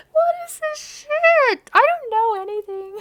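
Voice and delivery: very high, loud, drawn-out cry; high-pitched howl of embarrassment; mortified wail. Real, not acted. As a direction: wail in cringing disbelief. Mortified by the romance she reads.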